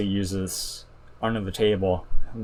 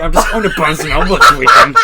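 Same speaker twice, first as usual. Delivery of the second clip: high, loud, fast mock voice; mocking parody; 7 semitones higher, 11 dB louder, faster than usual. Mocking coworker's line to Lucy, high loud parody. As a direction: parody him, fast and bright.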